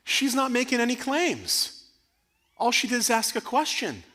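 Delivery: high-pitched, thrilled explanation; triumphant. Real, not acted. Teacher proud that student only asked a question, high-pitched triumph. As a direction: stay up high with delight.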